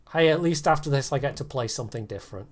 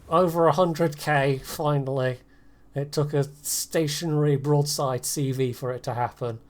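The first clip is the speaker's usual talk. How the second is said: usual speaking voice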